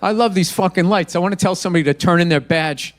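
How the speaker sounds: ordinary delivery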